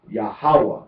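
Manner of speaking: "Yahweh" said ya-HA-wa, weight on the middle; stress on second syllable. Shown as a demonstration. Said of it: "on the second syllable is".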